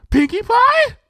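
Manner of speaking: very high loud eager question; excited guess. Acted. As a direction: blurt out the name eagerly.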